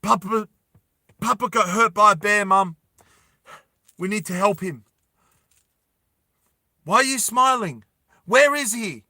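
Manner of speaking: panicked news of the bear; alarmed family. Acted.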